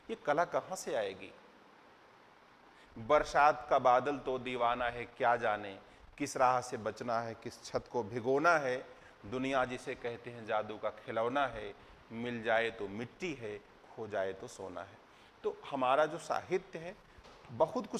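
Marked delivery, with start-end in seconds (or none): 3.0-15.0 lower-pitched, measured verse recitation; poem recital. Acted. Teacher quoting poetry to show what literature teaches, poem recital. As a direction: recite the couplets line by line, voice dropping at the close.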